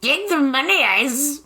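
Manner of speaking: hissing, pushy villain mother; wicked stepmother. Retold or imitated. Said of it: "she was very, like".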